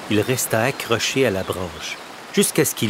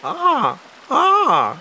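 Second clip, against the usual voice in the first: much higher sly crow voice; a pitched-up knowing "ah, ah"; crow musing to herself; 6 dB louder than usual. A narrator's reading. Crow thinking of the fish, high sly musing. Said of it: "se dit Corneille en elle-même".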